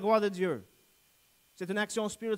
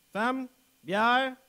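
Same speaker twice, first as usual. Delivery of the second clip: higher, louder demand to the wife; calling out orders; 4 semitones higher, 6 dB louder than usual. Acted. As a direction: call out like a demanding husband. Acts tired husband demanding things from wife. (ordre lancé à voix forte)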